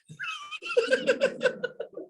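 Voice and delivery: high burst of real laughter; sudden amused high laugh. Real, not acted. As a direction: laugh out at the class's silence. Teacher laughs at class.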